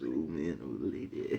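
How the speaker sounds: low, soft nephew voice; a hint of the nephew, low and quiet; imitating nephew again. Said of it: "Nephew says".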